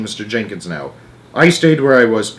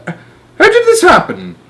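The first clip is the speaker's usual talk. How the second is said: high, slow bewildered plea; 7 dB louder, slower than usual. Acted. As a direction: ask with no wind left. Jenkins deflated.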